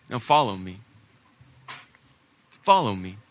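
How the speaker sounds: low, intimate "follow me"; grave, personal call; solemn invitation. Acted. The later call, set against first.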